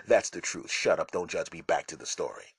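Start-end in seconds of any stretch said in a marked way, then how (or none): none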